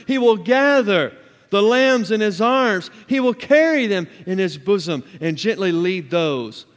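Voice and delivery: loud herald's cry, key words drawn out; proclaiming scripture. Acted. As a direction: preach the verse out at full voice. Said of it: "the rest of the chapter proclaims".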